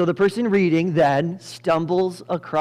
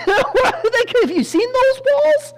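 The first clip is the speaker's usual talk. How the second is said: very high, loud squawk of disbelief; mock incredulous; 6 dB louder, slower than usual. Someone reacting to the Jericho battle plan, mock incredulous. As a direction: protest in comic, high-pitched shock.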